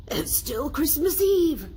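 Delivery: high, slow, wondering; amazed Scrooge. A narrator's reading. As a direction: marvel at it. Scrooge excited discovery.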